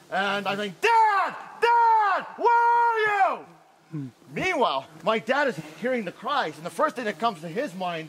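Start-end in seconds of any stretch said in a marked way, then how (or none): 0.8-4.1 high, loud, long-distance call; re-enacted cry for help. Son calling his distant dad for help, screaming. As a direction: holler for someone out of sight.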